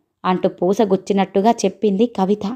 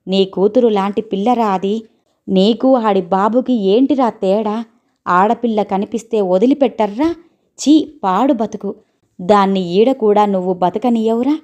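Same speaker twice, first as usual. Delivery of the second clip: ordinary delivery, nothing marked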